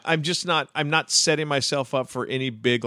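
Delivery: ordinary delivery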